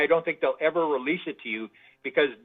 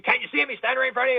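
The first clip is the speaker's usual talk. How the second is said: much higher, mock-frightened voice; retold panic; 10 semitones higher, much faster than usual. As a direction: plead like a man freaking out. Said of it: "the guy's just freaking out".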